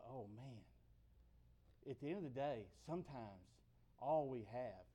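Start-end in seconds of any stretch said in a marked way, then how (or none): 0.1-0.6 disheartened sigh. Acted. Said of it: "and you just go".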